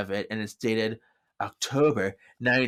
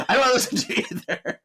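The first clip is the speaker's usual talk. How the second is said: fast, much higher, laughing through it; mocking dismissal; 12 semitones higher, faster than usual. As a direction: rattle it off high while laughing. [name] refusing to listen.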